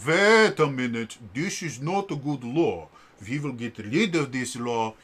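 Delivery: put-on foreign accent for the court, slow and emphatic on wait; fun non-American accent. Judicial branch objecting to a law, in a fun non-American accent. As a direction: play the judges in a hammed-up non-American accent. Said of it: "they're fun accents".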